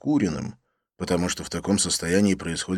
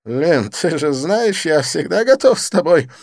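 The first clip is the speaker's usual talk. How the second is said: giggling; 9 semitones higher, 9 dB louder than usual. Acted. Didier teasing Lin with a double meaning, giggling. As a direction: let a giggle slip through. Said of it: "не сдержавшись, хихикнул Дидье".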